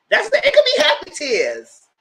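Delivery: laughing doubtfully. Real, not acted. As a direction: giggle it out in disbelief. Laughing at own guess.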